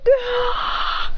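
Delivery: high scream on being blasted; held high-pitched yell; group scream. Acted. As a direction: cry out high as the attack hits. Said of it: "They go".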